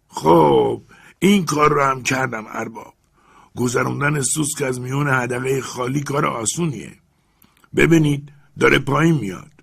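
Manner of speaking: put-on old servant calling down to his master, high and loud. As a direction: answer the master dutifully from up the tree. Jupiter reports.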